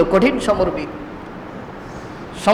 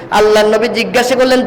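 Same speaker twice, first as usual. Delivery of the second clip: normal talking voice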